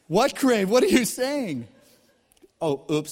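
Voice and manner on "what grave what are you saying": higher and louder, alarmed; shocked. Acted. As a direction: react in alarm to the news. Grandson shocked by text reading.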